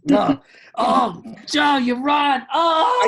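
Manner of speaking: high, loud delighted shout. Real, not acted. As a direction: cry out in real delight. Delighted reaction to monologue.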